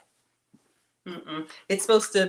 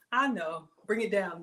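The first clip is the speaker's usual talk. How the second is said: normal talking voice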